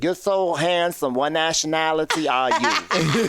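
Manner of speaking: lightly voiced old woman; old lady voice.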